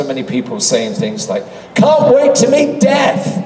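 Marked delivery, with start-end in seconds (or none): 1.8-3.4 loud, high, drawn-out excited cry; gushing fan. Retold or imitated. Actor mimicking fans at a convention, gushing loudly. Acting out excited fans' remarks.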